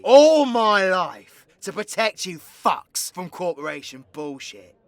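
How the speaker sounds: loud, aggrieved outburst; angry reproach. Acted. Outlaw berating the community they protected, loud and aggrieved. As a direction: throw it in their faces.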